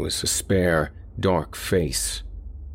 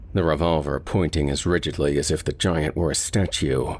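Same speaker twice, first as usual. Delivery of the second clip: ordinary delivery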